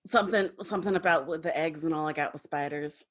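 a hint of a Southern accent. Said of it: "you're still doing the voice".

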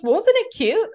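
gushing. Retold or imitated. Friend Yoi texting back, gushing. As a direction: sound delighted.

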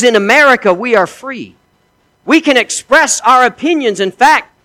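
mock-proud soldier in a retold joke; boastful American soldier. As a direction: crow about freedom to the Russian.

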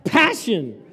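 a loud, fervent cry of the word; passionate. Shown as a demonstration. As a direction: say it again with real fire. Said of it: "Can I say it again?".

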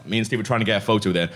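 usual speaking voice

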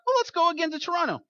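high, quick burst of mock eagerness; naive enthusiasm. Young friends deciding on another road trip, naively eager. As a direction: pitch it up and rush it, foolishly keen. Acts out young, foolish group's idea.

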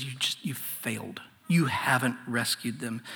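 soft whisper, almost gone; a hushed, bitter whisper of failure; whispered blame. Retold or imitated. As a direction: breathe the words out in quiet accusation. Moses blames God.

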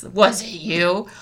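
hammed-up anger for first graders; angry voice. Shown as a demonstration. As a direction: punch out each word slowly as a playful accusation. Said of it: "say it like you're really angry".

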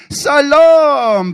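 high, loud, drawn-out bellow; roared back. Retold or imitated. Grown-ups in the middle answering him, roared. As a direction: roar the greeting back as a whole crowd. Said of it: "brølte tilbake".

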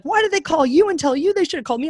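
indignant mom. Retold or imitated. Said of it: "She's like".

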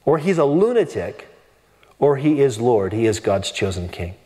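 normal talking voice

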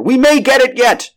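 high-pitched, loud rallying shout; urgent cry. A narrator's reading. Doctor rallying his men mid-chase, urgent cry. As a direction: urge the chasers on at full pitch. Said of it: "cried the doctor".

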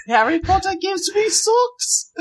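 very high squeaky elf voice, near shouting; mock Dobby. Mocking Katie as Dobby, squeaky and shrieking. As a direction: shriek it like a house-elf, laughing.

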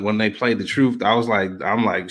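usual speaking voice

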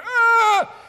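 loud, very high burst of frustration; loud high cry of frustration; frustrated yell. Retold or imitated. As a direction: let out a stuck writer's cry. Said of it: "you go".

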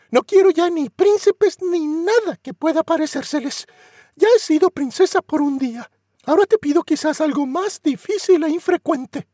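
put-on high young girl asking for an honest man; pleading Cinderella. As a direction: beg the godmother earnestly.

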